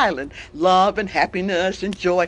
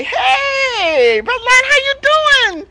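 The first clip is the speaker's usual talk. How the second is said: high, loud friendly greeting; cheerful monkey; 13 semitones higher, 6 dB louder, slower than usual. Acted. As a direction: sing out a hello as a chirpy monkey. Storyteller voices the monkey.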